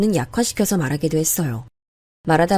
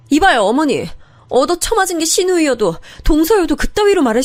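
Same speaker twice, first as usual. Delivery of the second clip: furious shouting; 10 semitones higher than usual. Acted. Daughter-in-law screaming at her mother-in-law, furious high yell. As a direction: scream it at her, losing all control. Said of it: "눈 뒤집고 정신 나가서 막 소리를 질렀나 봐요".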